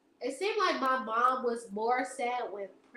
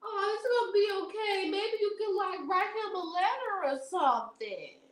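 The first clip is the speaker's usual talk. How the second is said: higher, soothing mock mom; imitating mom; 7 semitones higher than usual. Mom comforting her crying child, higher soothing. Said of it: "my mom was like".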